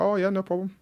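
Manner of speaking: high-pitched breezy "sure thing" quote; mock agreeableness. Mimics own agreeable reply to others.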